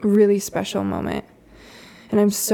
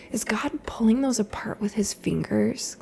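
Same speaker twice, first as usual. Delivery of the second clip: soft whispered wonder; 5 dB quieter than usual. Retold or imitated. Mom imitating her daughter asking about the clouds, whispered. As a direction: hush it like a child gazing at clouds.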